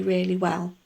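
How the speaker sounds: ordinary talk, nothing marked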